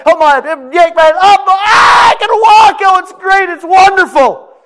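very high, loud shouting joy; healed man overjoyed; ecstatic healed man. Acted. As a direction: cry out that you can walk.

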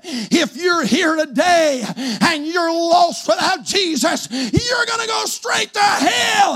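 imitating a young preacher, rising high on the last words; slow, drawn-out mock pulpit cry; acted hellfire preaching. Young preacher boy to the lost, imitated hellfire warning.

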